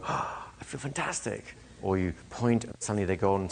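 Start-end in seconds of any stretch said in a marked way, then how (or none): 0.0-1.6 soft, slow, dreamy sigh of bliss; blissed-out client. Retold or imitated. Hypnotist voicing a client who feels blissful. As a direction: murmur it like someone floating in contentment. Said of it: "people go".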